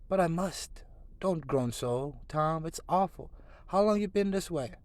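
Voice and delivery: high, rising frightened plea. Acted. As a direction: plead in growing fear. Frightened brother pleads.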